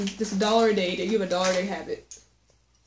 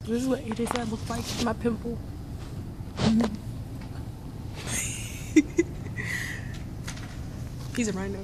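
hushed voice; 10 dB quieter than usual. Real, not acted. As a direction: keep it hushed so nobody hears. Hushed talk in the library.

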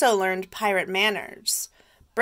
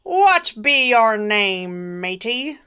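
pirate captain; 2 semitones higher, 7 dB louder, slower than usual. Acted. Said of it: "the head pirate asked".

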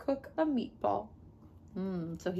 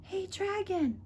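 softer, higher gentle call; 8 semitones higher, 8 dB quieter, slower than usual. Acted. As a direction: call him softly. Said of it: "whispered very softly".

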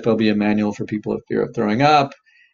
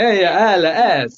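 high, loud, drawn-out mock Italian; very slow, exaggerated Italian accent; 6 semitones higher, 7 dB louder, much slower than usual. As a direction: ham up a silly Italian accent. Hammed mock accent, making words silly.

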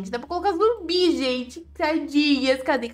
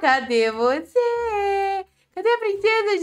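1 teasing high call; 7 semitones higher, slower than usual. Pretend. Calling out, slow high.

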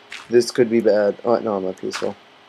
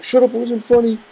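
sulky retort; 11 semitones higher than usual. Retold or imitated. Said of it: "I'll be like".

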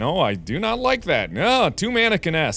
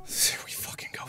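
whispered relief; 16 dB quieter, faster than usual. Real, not acted. Relief after finally passing.

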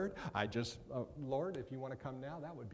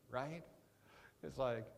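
ordinary talk

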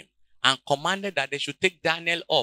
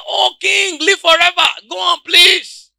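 calling up from the den; 13 semitones higher, 9 dB louder than usual. Acted. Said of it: "His voice said".

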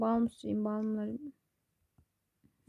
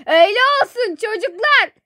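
high, loud mock-hurt call; loud wounded shout; 8 semitones higher, 8 dB louder than usual. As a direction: holler the reproach to the whole house. Shouts it down to the children. (yüksek sesle, sitemli bağırış)

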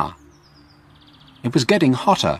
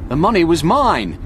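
higher-pitched, agitated protest, the name leaping up; protesting Frank; 5 semitones higher than usual. Acted. Frank tells the constable who took his money, protesting. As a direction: protest your innocence in a raised pitch.

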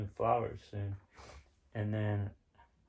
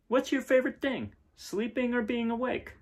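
put-on little farm girl asking; 13 semitones higher, 4 dB louder than usual. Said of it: "But she said".

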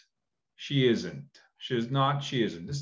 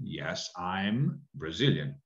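slow model answer; 5 semitones lower, 5 dB quieter, slower than usual. Shown as a demonstration. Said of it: "You say".

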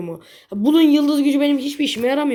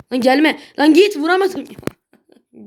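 frustrated yelling; 4 semitones higher, 6 dB louder, much slower than usual. Real, not acted. Enemy chases him in game.

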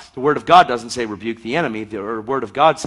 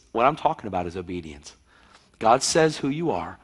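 ordinary delivery

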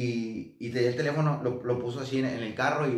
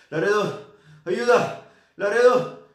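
loud, high, pleading cries of a man stuck in a nightmare; cries for help; 8 semitones higher, 8 dB louder, much slower than usual. Retold or imitated. Asleep friend calling Laredo for help, loud and high. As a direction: call out his friend's name in panic, pitch up and loud.

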